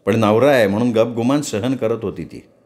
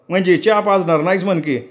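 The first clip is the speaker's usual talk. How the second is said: higher, complaining farmhand; servant Saravanya; 7 semitones higher than usual. Acted. Servant Saravanya complaining to Raghu about the load, lightly voiced. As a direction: grumble about the heavy sack.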